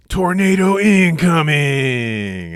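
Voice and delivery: high, drawn-out playful shout; mock disaster warning. Boy announcing a pretend storm to the ants, drawn-out mock shout. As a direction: call it out long and loud like a pretend siren.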